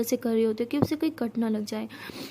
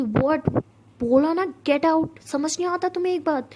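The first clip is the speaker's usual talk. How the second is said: angry snap; 4 semitones higher, slower than usual. Acted. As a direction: bark it at her. Sara snaps at the maid.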